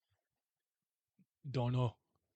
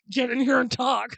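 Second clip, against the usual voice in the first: loud, higher bossy order; bossy demand; 10 semitones higher, 10 dB louder than usual. Pretend. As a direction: bark it like a pushy host. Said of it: "I'm not gonna be like".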